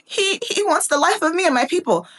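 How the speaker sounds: high, hammed-up tearful accusation; dramatic Esther. Preacher as Esther pleading for her people, mock dramatic. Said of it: "I'm making it dramatic".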